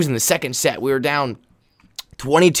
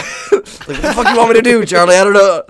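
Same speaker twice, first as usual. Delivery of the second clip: laughing complaint; 7 semitones higher, 5 dB louder than usual. Retold or imitated. Retold sideline complaint.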